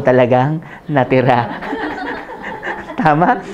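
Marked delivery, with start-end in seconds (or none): 1.5-2.9 breathy high burst of laughter; airy amused laugh. Real, not acted. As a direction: laugh at your own joke. Own punchline laugh.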